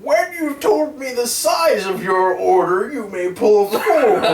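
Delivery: high, slow speaker voice; drive thru speaker. Pretend. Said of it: "They come back and redub over it".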